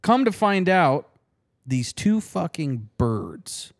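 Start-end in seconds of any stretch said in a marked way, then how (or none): none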